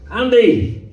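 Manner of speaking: calling out. Retold or imitated. Man calls from car window.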